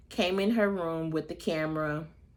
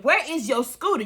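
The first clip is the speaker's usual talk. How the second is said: Nene Leakes line; 7 semitones higher, 5 dB louder than usual. Retold or imitated. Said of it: "Nene Leakes".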